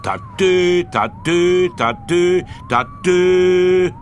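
high, loud siren call; siren imitation. A narrator's reading. The police car's siren sounds nonstop, imitated. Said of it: "tutete die Tute ununterbrochen".

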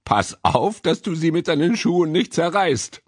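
playful warning, climbing high; amused warning. A narrator's reading. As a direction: warn in cheerful jest. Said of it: "Da lachte der Hosenträger und sagte".

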